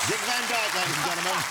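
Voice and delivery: high, loud showbiz announcement; mock announcer. Host ribs guest over his bad accent, mock announcer. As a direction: proclaim it like introducing a star act.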